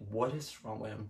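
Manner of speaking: hushed private reaction; inner thought. Retold or imitated. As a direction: mutter it as if only in your head. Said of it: "But I said it in my head".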